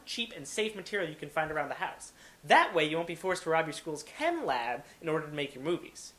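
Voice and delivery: normal talking voice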